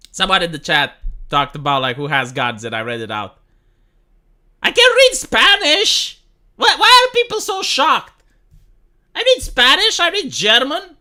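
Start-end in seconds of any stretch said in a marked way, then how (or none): 4.6-11.0 much higher and louder, defensive; indignant protest. Real, not acted. Streamer to a shocked chat, defensive and indignant. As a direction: insist you can read it.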